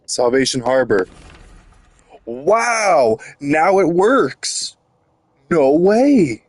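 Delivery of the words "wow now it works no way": mock amazement. Mock amazement at game finally working.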